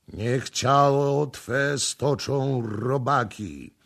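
ceremonial curse. A narrator's reading. Priest pouring tar into the pit, ceremonial curse. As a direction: lay the curse on slowly, as ritual. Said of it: "powtarzając ceremonię".

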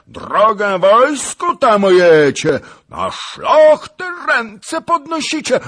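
furious, high-pitched screaming tirade; wrzask. A narrator's reading. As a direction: bellow threats at full volume. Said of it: "począł wrzeszczeć". (wściekły wrzask)

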